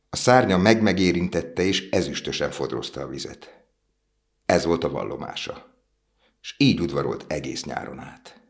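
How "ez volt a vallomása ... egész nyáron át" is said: plain reading voice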